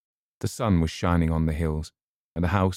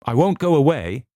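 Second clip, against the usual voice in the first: higher, louder defiant cry; defiant call upward; 9 semitones higher, 8 dB louder than usual. A narrator's reading. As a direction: call it up to the high windows. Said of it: "Eleanor said up to the high windows".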